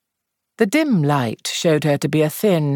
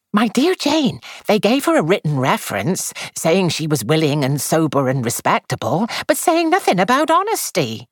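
Miss Weatherby voice; 7 semitones higher than usual. A narrator's reading.

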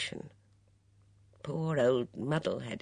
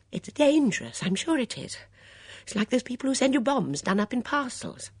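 put-on high, fluttery, worried old lady; a fussy old companion fretting; anxious old Dora; 10 semitones higher, 4 dB louder than usual. Dora frets to Miss Blacklock about the notice, high and anxious.

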